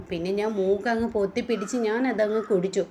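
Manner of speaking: plain reading voice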